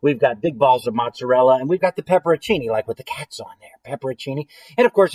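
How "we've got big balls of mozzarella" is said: usual speaking voice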